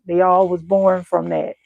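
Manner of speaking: usual speaking voice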